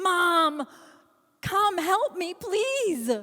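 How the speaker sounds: high, loud panicked scream; screaming for help. Acted. Panicked teen to her mother after botched haircut, screaming. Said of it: "I let out a scream".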